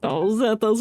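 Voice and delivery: usual speaking voice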